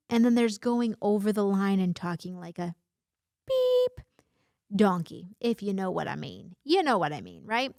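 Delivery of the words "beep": a sharp high beep said in place of a swear word; censor beep. Shown as a demonstration. Censoring a swear word for her viewers, high mouth beep. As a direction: bleep yourself out with a quick high-pitched beep. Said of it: "I'm gonna continue to censor myself".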